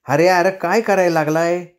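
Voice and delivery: a village sister pressing her brother on the phone; sister calling on the phone. A narrator's reading. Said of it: "पलीकडनं बाईचा आवाज ऐकू आला".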